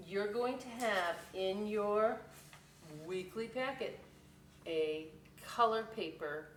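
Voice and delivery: ordinary delivery, nothing marked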